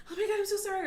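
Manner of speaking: high, loud imitation of a frantic child; profuse apology. Daughter after a mistake, high frantic mock apology. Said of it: "apologize profusely".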